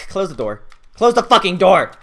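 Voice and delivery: from firm to a loud, high scream; building to a yell. Real, not acted. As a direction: escalate the order into a shout. Urgent demand building into scream.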